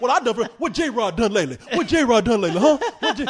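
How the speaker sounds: loud, high, indignant voice; mock caller. Caller J-Rod defending himself, loud and high. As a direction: demand it like an offended caller. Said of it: "He's like".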